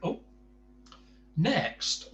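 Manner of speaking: usual speaking voice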